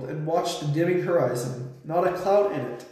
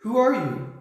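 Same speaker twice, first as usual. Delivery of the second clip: higher-pitched, mildly fearful question; trembling voice; 6 semitones higher than usual. A narrator's reading. Edmund questions the unknown voice, mildly trembling. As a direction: ask it with a touch of fear. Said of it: "I asked in a trembling voice".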